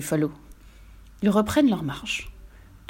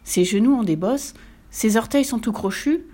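ordinary delivery

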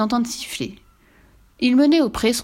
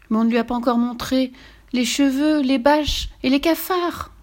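protesting; 6 semitones higher than usual. A narrator's reading. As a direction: protest like an indignant mother. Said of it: "protestait la comtesse". (protestation aiguë)

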